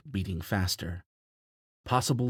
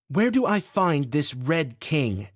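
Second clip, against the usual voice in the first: higher, louder, slow, pressing demand; firm demand; 7 semitones higher, 5 dB louder, slower than usual. A narrator's reading. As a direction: press him hard for an answer. Heroine demands answer.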